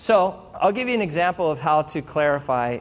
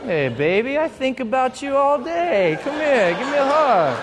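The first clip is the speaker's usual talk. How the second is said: higher, louder, hammed-up flirty coaxing; sweet-talking spouse; 7 semitones higher, 3 dB louder than usual. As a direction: ham up the lovey-dovey spouse. Spouse voiced playfully, comic exaggeration.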